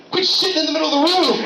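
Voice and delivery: high, loud furious roommate; outraged yell. Retold or imitated. Man yelling at roommate over mess, high and loud. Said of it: "you'd be like".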